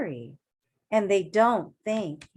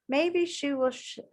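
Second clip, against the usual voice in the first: normal talking voice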